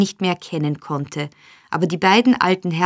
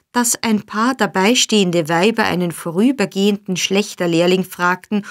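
ordinary delivery, nothing marked

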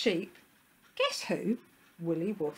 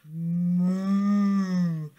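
sustained humming moo; cow mooing; 8 dB louder than usual. A narrator's reading. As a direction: moo like a placid cow, drawn out and loud. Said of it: "The cow looked at him".

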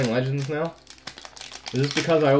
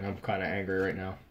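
normal talking voice

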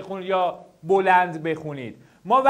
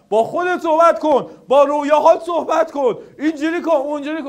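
higher, louder mock pep-talk advice; mocking other advisers; 5 semitones higher, 5 dB louder than usual. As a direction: parody the empty motivational advice. (تقلید تمسخرآمیز از نصیحت مشاوران، بلندتر و زیرتر)

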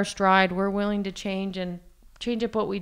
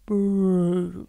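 vocal fry. Shown as a demonstration. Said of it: "Why do I keep doing that little vocal fry?".

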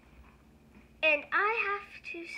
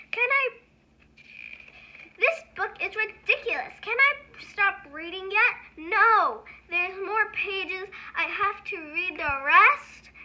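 higher, louder, indignant whining; exasperated protest; 4 semitones higher, 7 dB louder than usual. Pretend. Reader begging kids to stop reading. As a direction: plead to stop, fed up.

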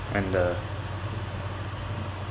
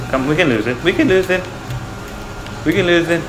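rising urgent hope; 8 semitones higher than usual. Real, not acted. Trying to escape cops.

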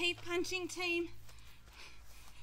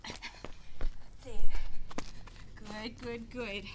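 shouted encouragement; 4 semitones lower, 10 dB louder, much slower than usual. Real, not acted. Coach calling out.

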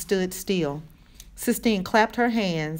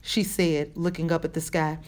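plain reading voice

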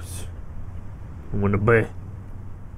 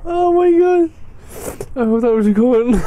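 laughing; 7 semitones higher than usual. Real, not acted. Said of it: "crying with laughter".

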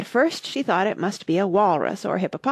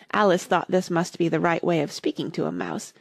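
plain reading voice

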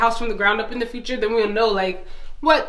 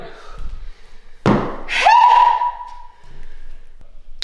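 catching breath. Real, not acted. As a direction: let out a heavy, winded breath. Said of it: "I gotta catch my breath".

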